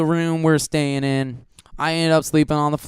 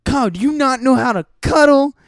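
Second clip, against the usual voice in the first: put-on girl scolding; 6 semitones higher than usual. Girl scolding Kyle about cuddling, higher and bossy. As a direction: lecture him sharply, pitched up. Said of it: "she's like".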